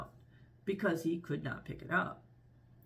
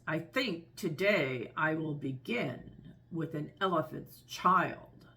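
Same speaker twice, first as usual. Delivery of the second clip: slow crocodile talk through clenched teeth; between the teeth; slower than usual. Acted. Crocodile holding the child's nose, slowly between his teeth. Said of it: "said it between his teeth like this".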